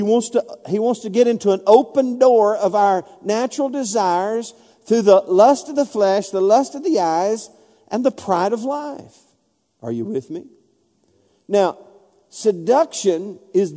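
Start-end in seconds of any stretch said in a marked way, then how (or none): none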